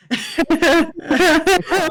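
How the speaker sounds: loud, high laughing fit; hard laughter. Real, not acted. Friend recalling the funniest theory, hard laughter. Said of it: "We were laughing so hard".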